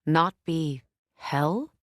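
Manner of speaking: usual speaking voice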